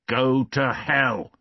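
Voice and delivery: put-on a man driving the child away; angry outburst. A narrator's reading. Valjean threatens the boy.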